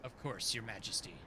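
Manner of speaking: low, solemn teacher voice; stern old mentor. Acted. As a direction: speak as a grave celestial master.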